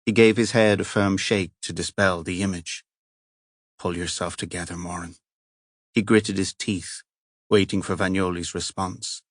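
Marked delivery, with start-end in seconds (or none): 3.8-5.2 muttered under his breath to himself; a soft, private rebuke to himself; quiet self-talk. Acted. Moran quietly urges himself to hold together.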